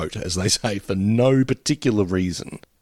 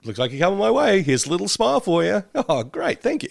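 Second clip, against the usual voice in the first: mock sweet; 8 semitones higher than usual. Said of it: "But, like".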